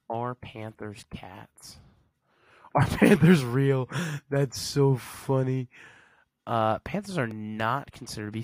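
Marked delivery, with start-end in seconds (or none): 0.1-1.8 slow, breathy whispered question. Pretend. 2.7-5.7 loud, slow laughing disbelief. Real, not acted.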